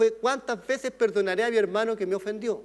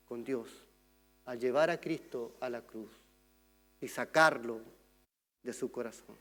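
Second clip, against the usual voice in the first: earnest hush; 4 semitones lower, 6 dB quieter than usual. Real, not acted. Preacher drops to intimate earnestness.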